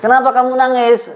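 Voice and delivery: very high mock questioner; concerned questioner. Playing someone asking a weeping person, exaggerated concern.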